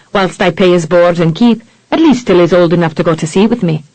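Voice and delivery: lightly voiced Irish sea captain; a touch of Irish lilt, matter-of-fact; Irish accent held through, understated; Irish brogue. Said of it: "Irish-infected brogue".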